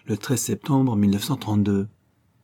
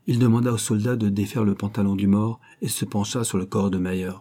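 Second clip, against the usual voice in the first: ordinary delivery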